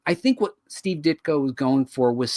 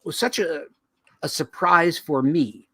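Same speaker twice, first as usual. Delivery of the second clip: normal talking voice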